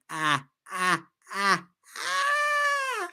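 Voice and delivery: high, spaced-out mock cackle; Leto Joker laugh. Fan asked for a Joker laugh, high staccato villain laugh. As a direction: laugh in separate "ah"s, shooting up high at the end. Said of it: "my Leto Joker laugh".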